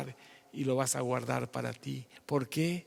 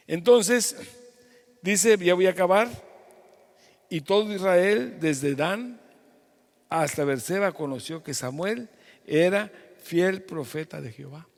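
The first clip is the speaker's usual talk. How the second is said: loud, raised preaching voice; preaching at full force; 5 semitones higher, 7 dB louder than usual. Real, not acted. Pastor proclaiming scripture to online congregation, loud preaching.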